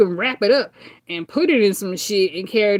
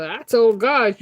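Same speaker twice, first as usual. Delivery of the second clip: ordinary talk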